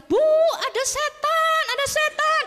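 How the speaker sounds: high, drawn-out panicked shriek; mock terrified scream. Nanny in the bathroom screaming to her employer about a ghost, mock terror. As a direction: imitate a frightened nanny screaming for help. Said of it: "pasti teriak-teriak".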